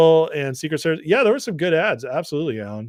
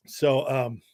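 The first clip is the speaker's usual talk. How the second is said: normal talking voice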